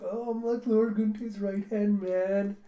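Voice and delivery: slow, high put-on boast; gloating nobleman. Nobleman proud to serve Lord Guntei, gloating high voice. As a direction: draw out the self-congratulation.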